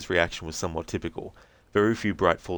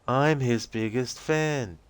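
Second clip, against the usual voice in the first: put-on high, slow, dreamy young woman's voice; spacey and childlike, drifting; dazed Rose; 6 semitones higher, much slower than usual. Rose answering the agent's questions, dazed and high-voiced.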